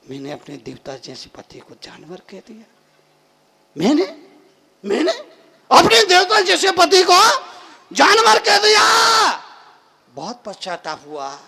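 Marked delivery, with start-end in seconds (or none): 0.1-2.6 very soft, low self-reproach; remorseful. Acted. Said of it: "उसको बड़ा पश्चाताप हुआ". 3.8-9.4 high, loud, appalled self-reproach; aghast remorse. Acted. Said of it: "उसको बड़ा पश्चाताप हुआ".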